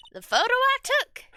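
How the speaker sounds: jolted by the photo; startled witness. Acted.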